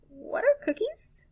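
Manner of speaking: high, puzzled question; puzzled alien. Acted. Alien asks the host about cookies, high and puzzled. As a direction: ask it high like an alien who has never heard the word.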